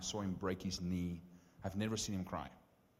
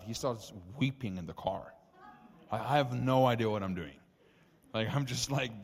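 ordinary talk

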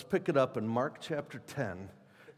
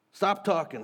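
mild mock scream; 5 semitones higher, 4 dB louder than usual. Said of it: "He screams it out again".

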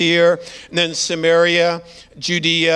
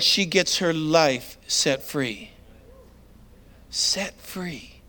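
hushed; 4 semitones lower, 6 dB quieter, much slower than usual. Real, not acted. Sermon climax, softened.

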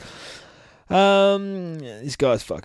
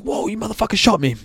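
high and fast, acted shock at being shot; a lightly acted Donatello, high and fast; shocked outburst; 12 semitones higher, 4 dB louder, faster than usual. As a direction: blurt it out as a startled turtle. Donatello wakes with gun in face.

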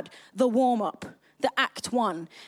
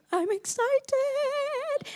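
high, drawn-out excited squeal; gasp, then stretch it out high with giddy excitement; heyecanlı: excited squeal; 12 semitones higher, much slower than usual. Retold or imitated. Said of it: "And I get this, like".

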